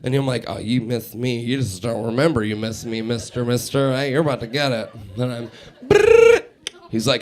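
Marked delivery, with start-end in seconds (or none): 0.5-4.9 low, soft acted drunken muttering; drunk caller. Drunk man waiting for his friend to answer, low mock-drunk grumble. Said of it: "So I got really drunk by myself". 5.9-6.4 high trilled ringtone; imitated phone ring. Man waiting on a late-night call, trilled ring.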